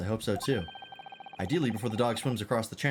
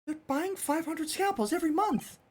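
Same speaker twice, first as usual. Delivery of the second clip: put-on much higher, polite young assistant; high girlish assistant voice. Assistant hoping the scent leads to the swindler, high and polite. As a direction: lift into a prim young lady's voice.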